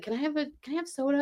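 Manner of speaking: put-on whiny kid. Little sibling asking big sister for soda, small pleading voice. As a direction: ask like a little kid begging.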